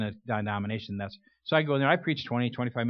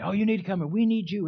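mock pleading deacon; 7 semitones higher than usual. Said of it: "Had hounded me and said".

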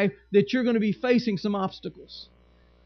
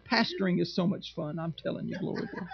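1 whispered aside; 4 semitones lower, 9 dB quieter than usual. Real, not acted. Wry aside after complaining.

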